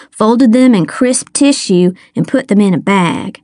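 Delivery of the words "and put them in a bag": ordinary delivery, nothing marked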